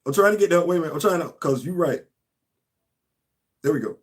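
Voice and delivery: ordinary delivery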